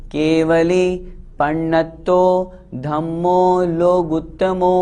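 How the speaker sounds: लोगुत्तमो sung slowly with a clear final o; correct pronunciation. Shown as a demonstration. Teacher shows students to say लोगुत्तमो, sung slowly. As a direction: chant लोगुत्तमो, ending on a long o. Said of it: "यहाँ लोगुत्तमो बोलना है।".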